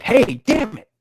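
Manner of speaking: high, loud exclamation; sudden annoyed yell; angry interruption. Acted. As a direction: call out crossly, pitched well up. Reader acts out an angry interruption.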